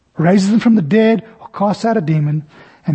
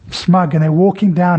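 ordinary talk

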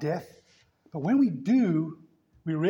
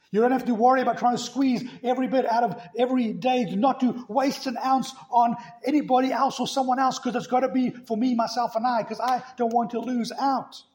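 mock selfish whine; 6 semitones higher, 4 dB louder than usual. Mocking a self-centred attitude.

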